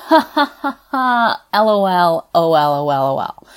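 high, loud mock laughter; spelled-out laugh. Client laughing in her therapist's face, loud and high. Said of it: "I promptly laughed in her face".

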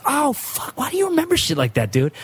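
high, baffled exclamation; incredulous amazement. Retold or imitated. Comedian amazed at his friend's memory, high-pitched and incredulous. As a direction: blurt it in mock disbelief.

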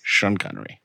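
low, badly faked Scottish accent; a hint of low mock Scottish; Sean Connery. Co-host repeats the name in character, low Scottish. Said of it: "this sounds nothing like Sean Connery".

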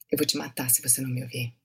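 soft, fast menacing line; hushed, deadpan Portuguese threat; cold vampire threat. Shown as a demonstration. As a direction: threaten him quietly without blinking. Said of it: "don't blink".

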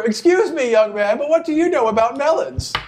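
put-on high, huffy old woman; indignant old lady. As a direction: scold the young man haughtily. GM voices melon woman.

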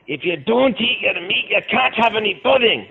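high mock Scottish yell; angry Scotsman. Quoting Pink Floyd as a high, angry Scotsman. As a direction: bark it like an angry Scots schoolmaster. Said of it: "there's something about a Scotsman who gets angry".

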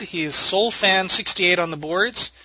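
normal talking voice

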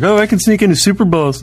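mock bragger. Mocking a bragging person.

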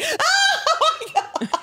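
very high, drawn-out laughing wail; mock pained squeal. Passenger hit by scalding cloth, mock agonised squeal.

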